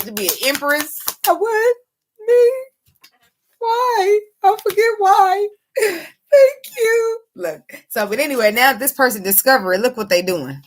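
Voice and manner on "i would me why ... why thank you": much higher, tearful cracking voice; moved to tears, thanking; real weeping, voice breaking; crying. Real, not acted. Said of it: "I get emotional".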